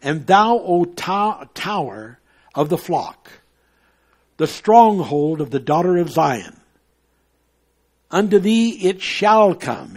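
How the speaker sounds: declaiming a Bible verse; scripture reading. Acted. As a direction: read it like a preacher proclaiming. Preacher reading scripture aloud.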